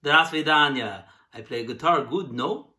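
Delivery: lightly hammed-up Russian accent; a hint of a Russian accent, playful. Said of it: "speak with a Russian accent if it's required".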